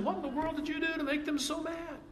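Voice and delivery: high, fast, disbelieving questions; like a mom scolding a kid; exasperated Pilate. Retold or imitated. Pilate to Jesus, echoing the scolding mom, high and exasperated. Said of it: "And Pilate's saying".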